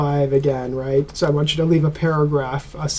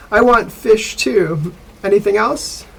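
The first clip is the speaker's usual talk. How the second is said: dialogue read aloud; 5 semitones higher, 4 dB louder than usual. Shown as a demonstration. Teacher reading the textbook dialogue to students, clear and slightly raised. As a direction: read the listening dialogue slightly higher for the class. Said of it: "I'll read it for you".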